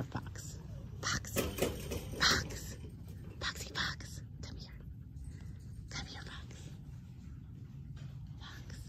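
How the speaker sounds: soft unvoiced whisper to a shy fox; gentle whispered call; whispered coaxing. Real, not acted. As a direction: breathe it so the fox isn't scared.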